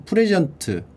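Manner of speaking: ordinary talk, nothing marked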